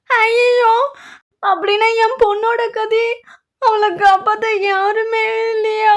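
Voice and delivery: high, loud wail breaking into sobs; panicked crying. Acted. Mother wailing for her trapped daughter, panicked sobbing. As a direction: cry out in despair for her daughter.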